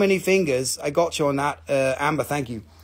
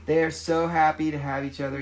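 ordinary delivery

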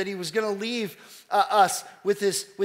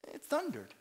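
hushed high crowd voice; 9 semitones higher, 9 dB quieter than usual. Retold or imitated. Crowd quoted.

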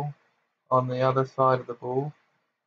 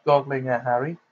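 usual speaking voice

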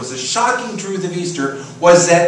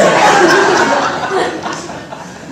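high laugh. Real, not acted. Pastor laughing at fitting the rabbit into Easter, high burst.

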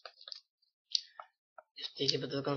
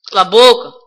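loud snapped shut up; angry shout; 3 semitones higher, 14 dB louder than usual. Real, not acted. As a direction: yell it at the screen. Angry outburst at game.

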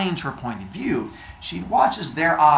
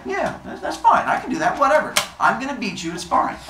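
offhand, higher-pitched mock bravado; Arachne; 9 semitones higher, faster than usual. Arachne accepting the goddess's challenge, high and dismissive. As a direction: toss it off in a high voice. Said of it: "And Arachne's like".

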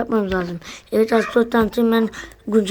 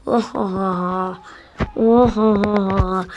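slow mock laugh; 2 semitones lower, much slower than usual.

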